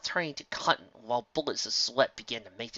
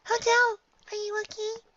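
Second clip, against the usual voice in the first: very high filly voice; sweet concerned little filly; sweet high-pitched voice; 4 dB louder than usual. Acted. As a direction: Sweetie Belle's worried high voice. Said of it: "a sweet, high-pitched voice said".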